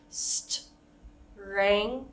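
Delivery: strength split into parts with pauses; chopped into pieces; broken up. Shown as a demonstration. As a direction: pause after each part of strength. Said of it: "we can even break it up".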